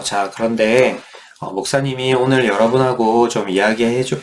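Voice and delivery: ordinary delivery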